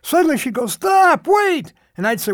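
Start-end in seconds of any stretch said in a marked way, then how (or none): 0.7-1.7 urgent call to stop. Retold or imitated. Wife calls out to stop car.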